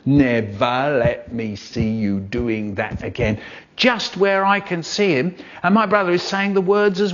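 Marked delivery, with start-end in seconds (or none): 0.1-3.4 slow, wagging-finger dad impression; mock stern dad. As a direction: drag it out like a telling-off father. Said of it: "my dad is poking me".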